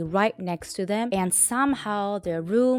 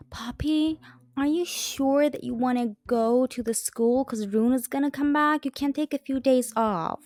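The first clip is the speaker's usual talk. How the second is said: higher, fussing parent voice; mock-concerned mom and dad; worried parent; 4 semitones higher than usual. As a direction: coax her like anxious parents. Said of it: "are like".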